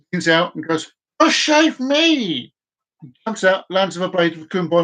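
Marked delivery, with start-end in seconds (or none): 1.2-2.5 mock cry out. Chinese man jumps in joke.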